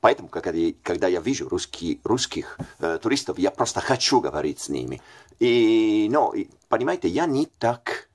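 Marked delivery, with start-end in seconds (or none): none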